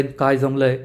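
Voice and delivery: plain reading voice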